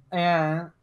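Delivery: ordinary talk, nothing marked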